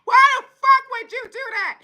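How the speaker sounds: yelling relative. Retold or imitated. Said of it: "they yelling at you".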